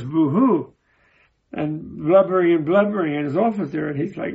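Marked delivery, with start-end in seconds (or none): none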